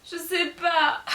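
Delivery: high-pitched, acted tearful reply; mock tearful. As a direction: blubber that you don't know, half laughing. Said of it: "pourquoi tu pleures".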